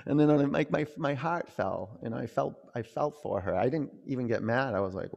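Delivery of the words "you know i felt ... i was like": ordinary talk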